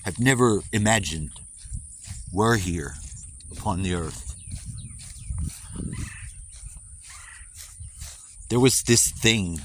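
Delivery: put-on solemn storybook narration, American accent; storyteller voice. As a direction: spin an ancient myth, American accent. Mock-solemn creation tale.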